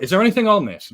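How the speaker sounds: high, loud retold question; acted-out confrontation; sharp demand. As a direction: demand an answer, pitch jumping up. Nico demands about the tape.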